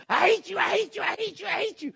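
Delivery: high, loud mock tantrum; hammed-up stomping-off kid yelling at a parent; shrill-pitched imitation of an angry girl; tantrumming daughter. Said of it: "stomped off for me and go".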